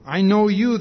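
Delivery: forceful reading. Acted. Preacher reads Jesus' words aloud.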